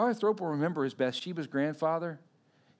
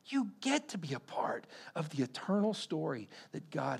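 hushed, whispered urging; soft intimate whisper; 2 semitones higher, 4 dB quieter than usual. Real, not acted. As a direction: lean in and whisper it. Sermon point, breathy hush.

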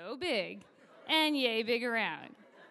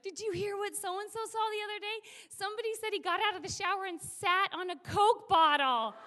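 higher-pitched, eager lounge gossip; building to a louder punchline; excited gossip; 7 semitones higher, 5 dB louder than usual. Acted. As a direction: dish the juicy story to a colleague.

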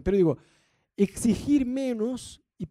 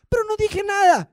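much higher, louder outraged "I said nothing"; indignant protest; 14 semitones higher, 8 dB louder than usual. Retold or imitated. Husband protesting to his wife, high and loud. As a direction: squeal it in offended disbelief. Spouses bickering.

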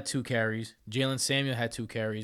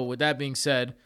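usual speaking voice

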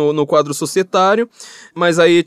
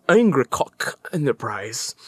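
exaggerated American accent; 3 semitones lower, 5 dB quieter, much slower than usual. Shown as a demonstration. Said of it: "sotaque americano bem forçado".

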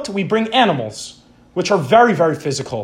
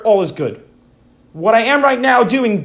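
ordinary talk, nothing marked

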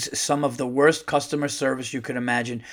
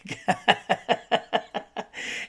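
high, voiceless rapid giggle; real fit of wheezy giggles; helpless laughter; 7 semitones higher, 6 dB quieter than usual. Real, not acted. Cracks up at own joke.

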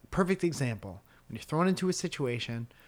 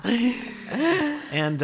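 high loud laughing fit; loud laughter; 13 semitones higher, 7 dB louder than usual. Real, not acted. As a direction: burst out laughing. Big burst of laughter.

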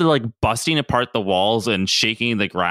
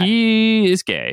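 mock revelation; 11 semitones higher, much slower than usual. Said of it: "I was like".

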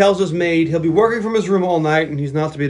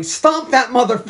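higher, louder urging shout; mock yell; 6 semitones higher, 4 dB louder than usual. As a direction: egg the hero on loudly. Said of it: "now I'm yelling".

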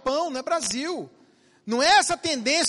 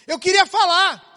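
mock protest; 7 semitones higher, 9 dB louder than usual. Wife protests in court.

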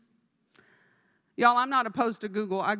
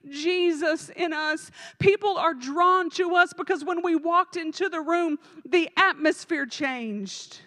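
raised, impassioned preaching voice; fervent preaching; 5 semitones higher, 6 dB louder than usual. Acted. Pastor pleading with the congregation, fervent preaching. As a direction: pray it out with full force over the church.

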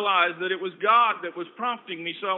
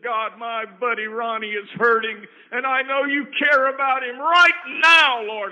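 loud, slower pleading prayer; swelling into an earnest loud plea; fervent prayer; 4 semitones higher, 9 dB louder than usual. Retold or imitated. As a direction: cry out to God for a hurting friend. Retold prayer over a hurting friend.

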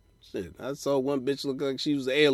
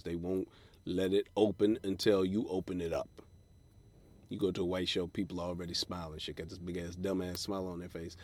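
ordinary delivery

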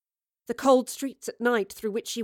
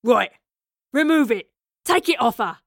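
loud, forceful commands in short bursts; yelled orders; 9 dB louder than usual. A narrator's reading. Leader ordering the device off the girl, yelling. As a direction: yell orders at your men. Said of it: "the man yelled at her".

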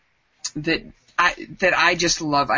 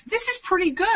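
son's voice. Retold or imitated. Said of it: "he goes".

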